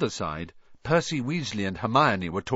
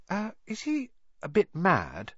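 put-on uncertain boy; 9 semitones higher than usual. A narrator's reading. Harry asks uncertainly.

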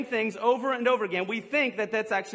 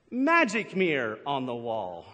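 "Magic mirror" said lower and slower, leaning on Magic; stress on Magic; 8 semitones lower, slower than usual. Shown as a demonstration. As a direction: stretch out "Magic" and press on it. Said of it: "She actually says".

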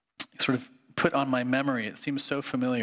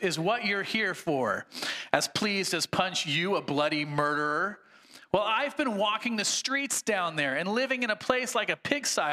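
high, aggrieved complaining ghost; indignant ghost; 6 semitones higher than usual. Acted. Sermon reading, the big ghost protests to the bright spirit, high indignant voice. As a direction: whine it like a wronged tough.

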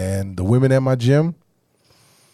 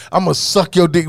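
mock aggressive, louder and higher; 6 semitones higher, 3 dB louder than usual. Shown as a demonstration. Man showing how she could say it aggressively, mock aggressive. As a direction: bark it as a playful threat. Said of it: "you can say it aggressively".

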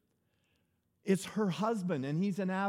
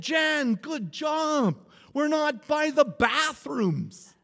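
high, loud retold call; comic raised-voice quote; mock exclamation; 8 semitones higher, 6 dB louder, much slower than usual. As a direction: call it out high, stretching the end.

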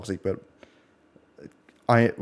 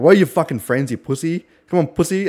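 mock screaming taunt; 6 semitones higher, 4 dB louder than usual. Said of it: "screaming in the voice chat".

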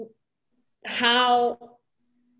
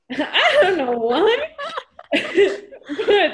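slowed words breaking into a laugh; real laughter; 7 semitones higher, 3 dB louder than usual. Real, not acted. Laughs at her own retelling.

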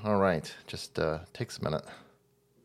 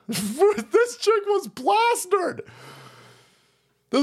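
laughing; slower than usual. Real, not acted. Laughing about drunk politician.

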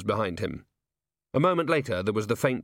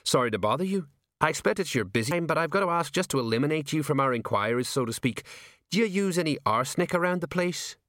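cheerful officer on routine inquiries, slightly higher; bright police sergeant; 6 semitones higher than usual. A narrator's reading. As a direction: pitch it up, breezily official. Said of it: "said Sergeant Colon brightly".

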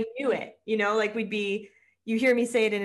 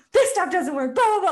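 exasperated venting; 11 semitones higher, 9 dB louder than usual. Retold or imitated. Friend retells Sam's frustrated venting.

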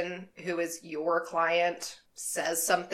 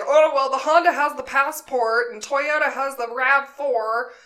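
louder, higher pushy salesman voice; imitating the driver; 6 semitones higher, 7 dB louder than usual. Driver listing Honda and Toyota models to passenger, mock salesman. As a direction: rattle off the models like a car salesman. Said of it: "And he's like".

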